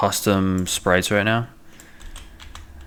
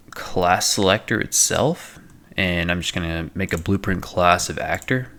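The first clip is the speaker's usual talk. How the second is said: ordinary talk, nothing marked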